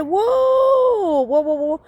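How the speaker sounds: very high, long "whoa" dropping into quick repeats; retold startled back-off; acted alarm. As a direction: hold the first whoa way up high. Acts out her reaction to his fit.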